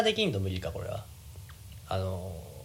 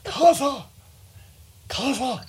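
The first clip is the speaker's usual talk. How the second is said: Hiraizumi impression; 10 semitones higher, 3 dB louder, much slower than usual. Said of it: "平泉聖って意外と".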